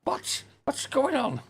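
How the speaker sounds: real disbelief, pitch jumps way up; genuinely can't believe the game; baffled frustration. Real, not acted. Gamer finding progress stolen again, real baffled outburst.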